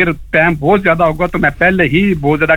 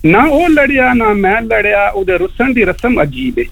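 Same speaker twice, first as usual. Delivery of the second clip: higher, declaimed verse; poem recitation; 6 semitones higher than usual. Shown as a demonstration. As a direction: lift the voice to deliver the couplet. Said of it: "یہ میں چھوٹی سی شاعری لے کے آیا ہوں". (اونچے سُر میں شعر سنانا)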